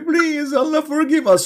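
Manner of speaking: high, whiny plea for forgiveness; begging plea. Retold or imitated. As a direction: whimper a mock prayer. Mock begging sinner voice.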